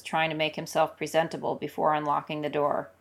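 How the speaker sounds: usual speaking voice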